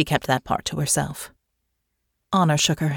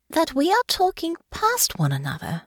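careful realization; 11 semitones higher than usual. Acted. Honor realizes the misunderstanding.

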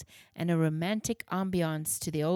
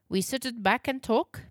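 put-on higher, inviting German accent; 5 semitones higher, 4 dB louder than usual. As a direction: offer a seat with German accent. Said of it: "Herr Gutmann had a heavy German accent".

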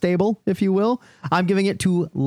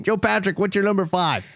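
usual speaking voice

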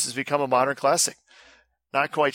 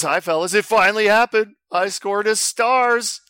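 upbeat radio announcer read; higher, louder announcer-style email read; radio show voice; 7 semitones higher, 5 dB louder than usual. Shown as a demonstration. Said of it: "I do my radio show voice".

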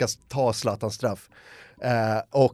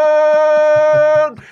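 huge high yell of "Zlatan!", much higher and much louder; jungle roar; 14 semitones higher, 10 dB louder than usual. Retold or imitated. Kid signalling all the other kids, jungle roar. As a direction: bellow "Zlatan!" like a jungle call. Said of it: "djungelvrål som en signal till alla kids".

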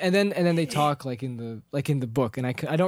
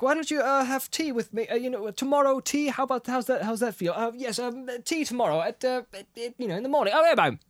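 flustered Bilbo; 9 semitones higher than usual. Retold or imitated. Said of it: "he's like".

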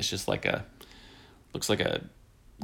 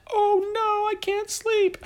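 high, hammed-up mock fright, much higher; mock scared; slower than usual. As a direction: play a fake-frightened kid, squeaky and high.

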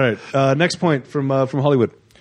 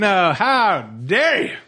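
mock outrage; 5 semitones higher, much slower than usual. Mock-offended retort in argument.

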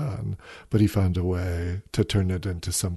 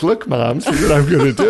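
put-on proud little kid showing off, higher and louder; beaming toddler; 5 semitones higher, 6 dB louder than usual. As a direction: imitate a delighted child calling to mom. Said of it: "like, beaming".

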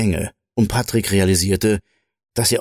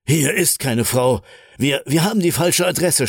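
higher-pitched, stumbling disbelief; shocked stammer; 6 semitones higher than usual. A narrator's reading. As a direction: shaken realisation, voice pitched up. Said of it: "stammelte er schockiert".

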